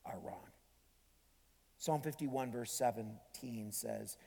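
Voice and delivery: ordinary talk, nothing marked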